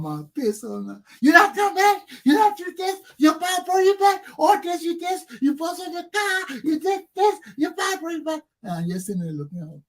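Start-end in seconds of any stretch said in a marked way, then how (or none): 1.2-8.4 very high-pitched nagging mom; scolding mother. Acted. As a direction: fire off a high, mock tirade. Acting out mother scolding him.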